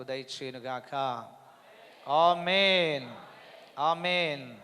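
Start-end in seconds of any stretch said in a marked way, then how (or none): 2.1-4.6 loud, slow stretched amen; drawn-out amen. Acted. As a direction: hold the amen long and strong.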